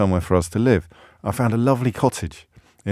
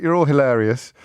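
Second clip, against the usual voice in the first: usual speaking voice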